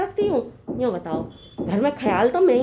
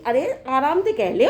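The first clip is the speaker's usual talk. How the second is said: usual speaking voice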